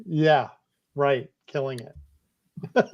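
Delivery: slow, drawn-out sarcasm; dry, unhurried disbelief; sarcastic. Real, not acted. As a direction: drag it out as if you doubt it. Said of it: "you sounded really sarcastic".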